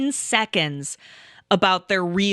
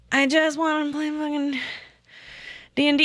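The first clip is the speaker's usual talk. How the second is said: higher-pitched mimicked sulk; mock whiny tantrum; 5 semitones higher than usual. Mocking grown man who wants the game, whiny. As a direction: drag it out like a sulky child.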